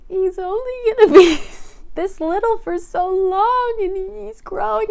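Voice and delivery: high, teary voice breaking into a laugh; crying lament. Retold or imitated. Telling her husband why she's crying, high and teary. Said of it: "And I was crying".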